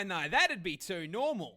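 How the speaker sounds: ordinary talk, nothing marked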